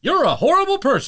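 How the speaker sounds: high, loud, hammed-up game voice; mock announcer. Quoting the achievement to his co-host, high loud mock system voice. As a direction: shout it like a smug game system.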